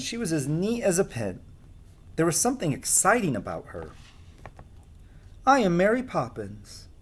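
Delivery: lively storybook reading; reading to children. A narrator's reading. Storyteller reading a picture book to children, lively. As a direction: read it like a bedtime story.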